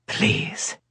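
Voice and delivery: husky low plea, almost whispered; a man coaxing her to taste, raspy. A narrator's reading. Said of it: "His voice had a raspy note".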